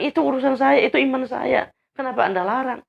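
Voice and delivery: high-pitched indignant protest; retold defiant comeback; heated retort. Retold quarrel with his boss.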